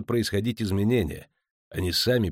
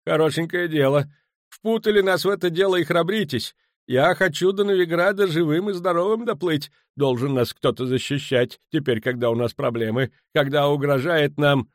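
high, loud, agitated complaint; worked-up, high-pitched outburst; panicked protest; 9 semitones higher, 5 dB louder than usual. Acted. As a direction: protest indignantly, fearing for your life. Panicking merchant protests to the captain.

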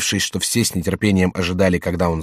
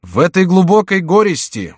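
high, louder oratorical address; resume the prince's speech, pitched up and emphatic; firm voice; 9 semitones higher, 5 dB louder than usual. A narrator's reading. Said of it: "Герцог продолжал более твёрдым голосом". (твёрдо и громко, как оратор)

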